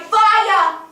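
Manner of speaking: high, loud warning shout; acted cry of alarm. Survivor warning people below from a window, crying out. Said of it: "I cried".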